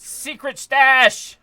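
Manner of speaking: slow, high, loud delighted call; excited. Real, not acted. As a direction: savour the discovery out loud. Finds a hidden stash.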